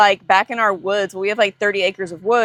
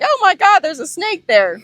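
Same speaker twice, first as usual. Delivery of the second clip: high, loud retold shriek of alarm; startled; 8 semitones higher, 3 dB louder than usual. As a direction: jump at seeing the snake. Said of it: "I was like".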